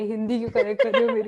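loud, prying auntie voice; nosy neighbor aunty. Pretend. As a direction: scold like the auntie next door. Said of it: "पड़ोस वाली aunty की आवाज में".

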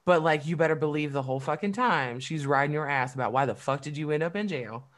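ordinary delivery

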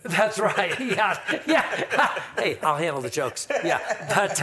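real laughter breaking through the words; laughing. Real, not acted. Guest laughing at the host's weed-smoking joke, real laughter.

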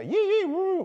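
high-pitched whooping call; made-up sheep call. Shown as a demonstration. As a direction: yip and whoop an invented animal call. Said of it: "I'm gonna make up a noise".

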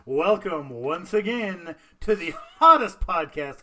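booming ringmaster announcer intro. Pretend. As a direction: call it out like a circus ringmaster. Circus-style show opening.

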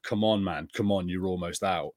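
usual speaking voice

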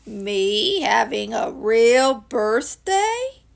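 very slow donkey voice, rising at the end; ponderous, doleful question; disbelieving gloomy Eeyore. Acted. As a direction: drag out each word in doubt.

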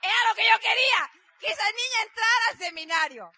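high, loud exclamation of victory; triumphant shout. Real, not acted. Speaker tells the audience her wish came true, shouting in triumph. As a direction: belt it out like you just won. Triumphant, telling what she wanted.

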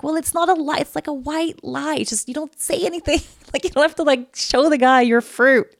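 high, giggling explanation; laughing. Retold or imitated. Telling Maya to hide the fruit, giggling. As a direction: laugh through the advice.

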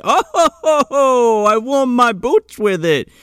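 high ho-ho-ho Santa laugh; jolly Santa chuckle. Retold or imitated.

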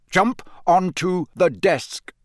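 slow, lifted, hypnotic order. A narrator's reading. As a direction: draw it out like a voice in a dream. Command repeated higher.

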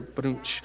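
low, soft imitation drum sting; mouthed rimshot. Shown as a demonstration. Preacher caps his own joke, mouthed rimshot. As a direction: punctuate the joke with a vocal rimshot.

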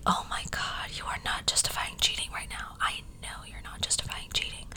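hushed, shocked whisper. Retold or imitated. Stunned whisper at a TikToker justifying cheating. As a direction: whisper it in horrified disbelief. Her retold reaction to TikToker.

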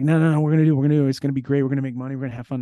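high, loud, eager brush-off; mock excited insistence. The star brushing off his guard's warning, high and eager. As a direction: hype it up like nothing can go wrong. Tommy eagerly dismissing the danger.

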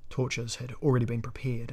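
usual speaking voice

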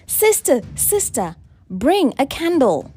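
high, loud call to a sister. A narrator's reading. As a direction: call out urgently, stretching the command. Sister calls for a candle.

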